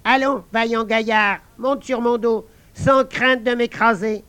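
high, light talking frog; a small frog giving orders. Acted. Frog asking the guard to set her down, high voice.

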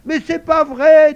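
high, loud, drawn-out protest; indignant child's wail. A narrator's reading. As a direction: protest like an outraged little girl. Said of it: "dit Malika avec indignation".

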